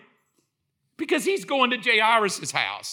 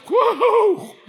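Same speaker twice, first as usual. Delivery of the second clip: high, loud whoop; exhilarated preacher's whoop; excited whoop; 12 semitones higher, 7 dB louder than usual. Real, not acted. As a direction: let out a sudden high whoop. Preacher whoops after the quote.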